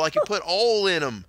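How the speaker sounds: high Southern customer, oil as "all"; Southern accent. Retold or imitated. Customer explains to the baffled worker, Southern accent. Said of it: "you don't speak properly".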